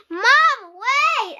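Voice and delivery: high, loud desperate shout; yelling. Acted. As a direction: yell to stop mom from swinging. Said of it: "I yelled".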